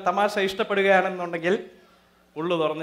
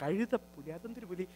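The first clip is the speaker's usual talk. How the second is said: deflated; 6 semitones lower, 7 dB quieter than usual. Acted. Insulted, called a hyena.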